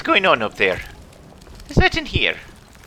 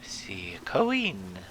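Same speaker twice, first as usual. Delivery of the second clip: whisper; 6 semitones lower, 9 dB quieter, slower than usual. Real, not acted. Reading symbols in eerie room.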